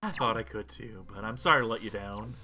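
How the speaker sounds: usual speaking voice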